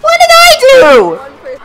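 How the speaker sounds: very high, drawn-out cry of despair; melodramatic wail. Pretend. Losing player bemoaning their fate, melodramatic wail. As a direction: wail it slowly, sky-high. Mock lament after losing.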